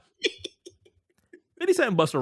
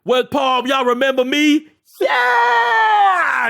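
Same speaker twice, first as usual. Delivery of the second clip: loud arena shout to the crowd, pitched up; hype-man shout; 7 semitones higher, 5 dB louder, much slower than usual. Pretend. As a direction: work up the hometown crowd. Impersonating rapper hyping a crowd.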